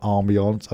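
"ambiance" said the French way, ahm-bee-AHNS; French-style pronunciation. Shown as a demonstration. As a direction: say "ambiance" low, with a French-sounding ending. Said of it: "I don't know why I said it like that".

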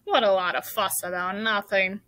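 grumbling, scornful rat voice; muttering rat. A narrator's reading. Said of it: "muttered the rat".

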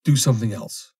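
frantic plea repeated. Acted. Foster pleading again.

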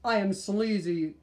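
loud booming evil king; hammed-up evil king; villain voice. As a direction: boom like a toy villain.

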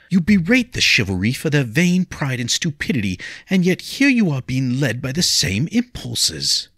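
put-on pleading young lady. Lady begs William not to fight, high and pleading. Leah reproaches.

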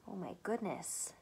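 ordinary talk

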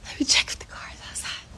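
hushed whisper as if being watched. Pretend. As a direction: whisper it secretly, quickly. Checking for a car outside, joking.

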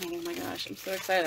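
ordinary delivery

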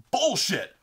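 short mock-furious yell; pissed-off outburst. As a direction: imitate a brother's first indignant shout. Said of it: "He was so fucking pissed".

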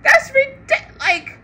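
real laughing shout; laughing outburst. Real, not acted. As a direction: burst out mid-word, laughing.

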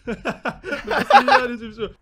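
talking through laughter; slow words spilling out between laughs; laughing. Real, not acted. Laughing after revealing the prank.

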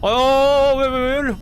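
높고 크게 터지는 비명; 겁에 질려 말을 더듬으며 소리치기. Real, not acted. 공포 게임, 갑자기 문이 열림. (기겁하며 지르는 소리)

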